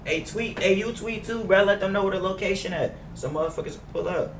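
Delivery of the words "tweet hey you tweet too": ordinary delivery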